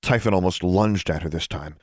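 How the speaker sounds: ordinary delivery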